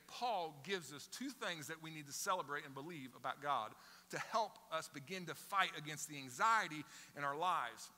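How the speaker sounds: ordinary delivery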